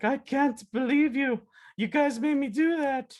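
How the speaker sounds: high, drawn-out cartoon squeal; giddy mock disbelief, pitched high; scandalized troll. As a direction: protest theatrically in a high showy voice. Acting troll after his stunt.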